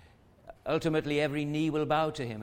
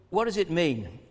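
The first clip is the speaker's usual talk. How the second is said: normal talking voice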